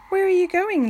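high, loud call of a busy mother; mother's call. A narrator's reading. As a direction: call it out to the little duck. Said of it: "she asked".

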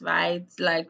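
usual speaking voice

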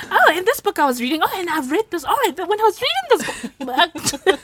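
high, laughing, tumbling over each other; mock excited rambling. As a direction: gush in a high voice, breaking into laughter. Mimicking herself excitedly recalling books.

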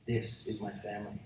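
low, soft restatement; hushed, low declaration; lion's claim, hushed. Acted. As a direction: say it deep and quiet, letting it sink in. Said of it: "reasons why a lion roars".

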